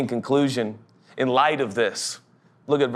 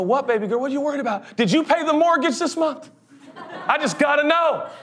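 put-on higher, laughing dad voice talking to his little girl; a dad cooing at his daughter, higher and laughing; doting dad; 10 semitones higher than usual. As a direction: play the sweet dad, giggling through it. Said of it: "I'm gonna go".

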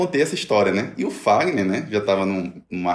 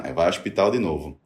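ordinary talk

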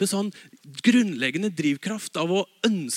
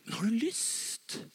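gentle invitation; 4 semitones higher, 7 dB quieter, slower than usual. Acted.

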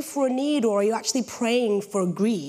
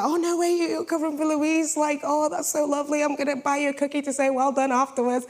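higher-pitched gushing friend impression; imitating enthusiastic friend; 3 semitones higher than usual. Friend offering a cookie as a well done, gushing. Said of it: "she was like".

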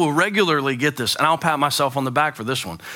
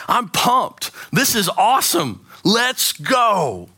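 put-on pumped-up kid; 6 semitones higher, much slower than usual. Pastor acting out teen slang to the congregation, hyped. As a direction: play the excited teen. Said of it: "slang for essentially excited".

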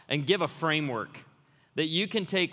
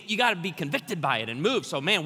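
normal talking voice

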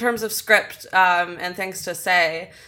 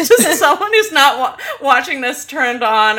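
laughing; 7 semitones higher, 7 dB louder than usual. Real, not acted. Laughing at her own videos.

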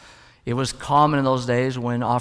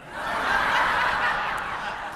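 high breathy burst of laughter; genuinely cracking up at the punchline; real laugh; 5 dB quieter than usual. Real, not acted. Preacher laughs at his own joke.

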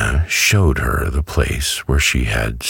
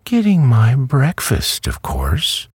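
plain reading voice

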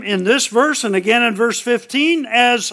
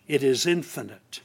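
usual speaking voice

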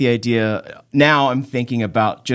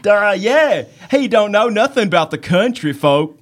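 higher dopey country voice; Southern idiot; 6 semitones higher than usual. Pretend. Southern idiot mocking the normal man, dopey country accent. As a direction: agree like a dim Southerner. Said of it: "And Southern idiot".

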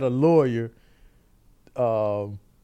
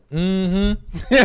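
drawn-out, higher hum of mock agreement; loud mm-hmm; 4 semitones higher than usual. Women on the prosecution side backing the argument, mock loud mm-hmm. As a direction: hum it pointedly, imitating approving onlookers. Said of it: "Loud as hell".

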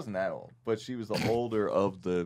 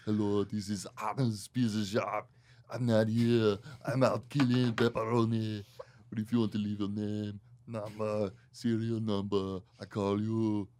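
put-on Arnold accent, phone-message style; Arnold Schwarzenegger; 2 semitones higher than usual. Retelling a prank bit, imitating Arnold's accented answering message. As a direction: recite a voicemail greeting in mock Arnold accent. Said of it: "That's Arnold down there".

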